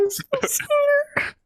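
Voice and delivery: put-on bright anime girl calling out; high girl voice. Voicing the girl Himitsu.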